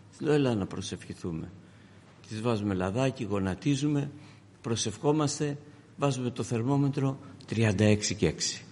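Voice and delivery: low, soft, intimate retelling; quiet storytelling. Retold or imitated. Preacher quietly recounts his sick daughter's fever. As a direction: tell it close and hushed.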